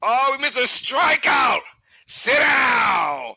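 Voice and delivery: high, laughing protest at the TV; dismayed outcry. Real, not acted. Fan yelling at the broken stream, high half-laughing outcry. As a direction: wail in mock outrage. Missed a strikeout, yells at TV.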